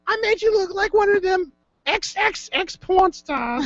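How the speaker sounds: high, slow mock-mom voice; mom impression. Host mimics co-host's mom boasting of the hairdo, high mom impression. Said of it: "that's how your mom sounds".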